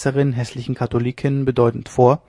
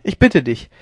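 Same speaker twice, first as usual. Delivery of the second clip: usual speaking voice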